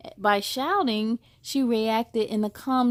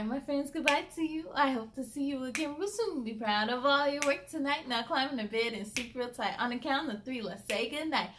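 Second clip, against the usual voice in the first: soft sing-song goodnight rhyme; bedtime rhyme; 2 semitones higher, 7 dB quieter, faster than usual. Acted. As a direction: lull them toward sleep with the rhyme.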